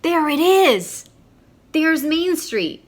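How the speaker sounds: high, loud, slow cry of discovery; excited spotting. Acted. Boy flying over town spotting his old street, excited high shout. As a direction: shout it out as if spotting home from the sky.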